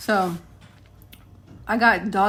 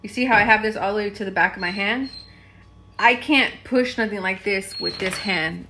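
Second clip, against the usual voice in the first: ordinary talk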